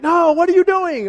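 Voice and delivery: put-on half-joking alarm at the kid; exasperated parent. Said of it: "you still be like".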